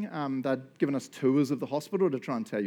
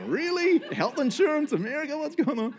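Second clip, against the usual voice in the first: high, disbelieving questions; can't believe it, America; mock indignant disbelief; incredulous; 11 semitones higher than usual. Disbelief at storeroom birth.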